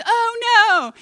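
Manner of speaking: high, drawn-out wail of dismay; acted cry of horrified defeat; mock scream. Devil realizing Jesus is alive, screaming. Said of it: "and he screams".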